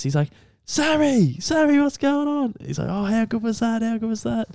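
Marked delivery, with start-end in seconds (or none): 0.6-2.5 very high, delighted mock call; excited dad impression. Dad greeting the footballer on the phone, excited. 2.9-4.5 high, gushing mimicked excitement; elated footballer impression. Footballer to Dad after the grand final, elated.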